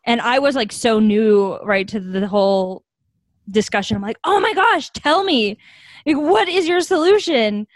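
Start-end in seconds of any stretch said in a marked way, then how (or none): 4.2-7.7 high, eager mock-gushing "tell me"; eager curiosity. To the man with a solution, a high, eager retold plea. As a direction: act thrilled to hear his big idea. Eager reply to stranger's idea.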